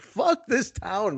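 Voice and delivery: exasperated fed-up retort. Retold or imitated. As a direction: spit it out slowly, done with the city.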